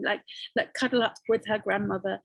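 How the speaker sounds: ordinary delivery, nothing marked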